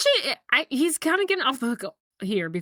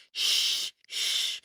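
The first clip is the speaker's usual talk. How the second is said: soft, hushed soothing sound; consoling shush; 6 dB quieter than usual. Retold or imitated. Father hugs his crying son, consoling shush. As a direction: imitate hushing someone who is crying. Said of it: "he tries to console him".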